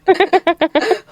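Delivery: high cackling laugh; loud burst of laughter. Real, not acted. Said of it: "I'm gonna have to censor that".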